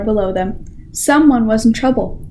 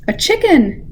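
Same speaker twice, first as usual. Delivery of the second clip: a mild storybook shout, higher; 6 semitones higher than usual. A narrator's reading. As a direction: call out together in light surprise. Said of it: "they all shouted".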